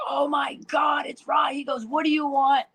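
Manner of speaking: high, shouting, thrilled drive-thru guy; the drive-thru worker spotting Raj, shouting with joy; excited fan. Retold or imitated. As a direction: squeal like a starstruck fan. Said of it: "he was like".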